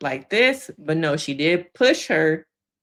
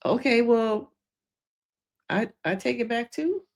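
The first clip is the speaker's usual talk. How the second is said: higher, hesitant and caught off guard, rising at the end; taken aback, pitched up into a questioning finish; retold surprise; 6 semitones higher than usual. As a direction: imitate a surprised, uncertain concession. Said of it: "She's surprised".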